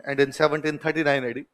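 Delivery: teacher repeating the date softly for notes; slow soft dictation. Shown as a demonstration. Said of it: "लिख लो".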